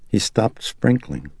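ordinary delivery, nothing marked

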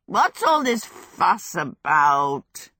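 slow, odd-voiced grumbling demand; a drowsy fire spirit wanting answers; lightly voiced crackly demon, slow and demanding; sleepy fire demon demanding. A narrator's reading. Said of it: "he demanded".

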